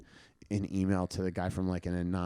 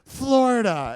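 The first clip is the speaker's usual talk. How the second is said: much higher, louder snapped answer; Danny's high voice; 13 semitones higher, 9 dB louder, slower than usual. Retold or imitated. Danny answers where Vero Beach is, high and indignant. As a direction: spit out the state indignantly. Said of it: "He's like".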